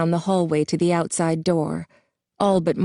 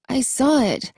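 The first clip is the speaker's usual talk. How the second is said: weak insisting; 7 semitones higher than usual. A narrator's reading. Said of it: "he insisted, more weakly".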